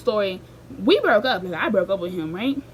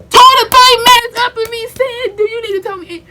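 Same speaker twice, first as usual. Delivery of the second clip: much higher and louder, words spilling out; outraged storyteller reliving the shock; shocked outburst; 11 semitones higher, 12 dB louder than usual. Real, not acted. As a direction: exclaim in stunned disbelief. Retelling the betrayal in shock.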